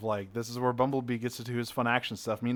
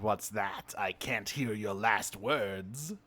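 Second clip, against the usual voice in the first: slow, drawn-out villain gloat; mock villain taunt; 4 semitones lower, 5 dB quieter, slower than usual. Villain leaning over his mumbling victim, taunting slowly. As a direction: taunt slowly, a bit lower.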